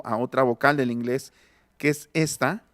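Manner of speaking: ordinary talk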